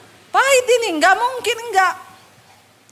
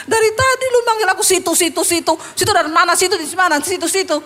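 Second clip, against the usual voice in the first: mock indignation; 5 semitones higher, faster than usual. Pastor silently griping at rude address, mock indignation. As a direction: throw the word back in exasperation. Inner mock outburst at being called situ.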